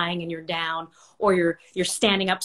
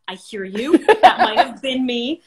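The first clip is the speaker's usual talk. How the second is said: ordinary talk, nothing marked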